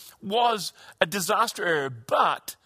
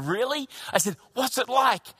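eager anticipation; 6 semitones higher than usual. Retold or imitated. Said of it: "with great anticipation".

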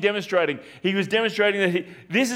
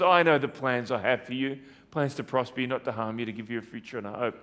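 much softer and lower, fading to near hush; gentle reassurance; 6 semitones lower, 5 dB quieter, faster than usual. Acted. Preacher quotes the Lord's promise gently and softly. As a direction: speak God's promise tenderly.

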